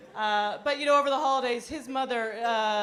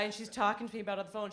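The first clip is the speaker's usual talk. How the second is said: ordinary talk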